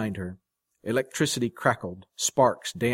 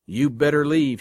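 louder, higher warning; stern warning; 4 semitones higher, 6 dB louder than usual. A narrator's reading. Guitarist warning the jealous man, stern. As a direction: warn him off forcefully. Andy warning his angry rival.

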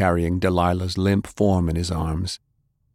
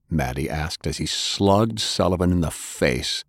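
plain reading voice

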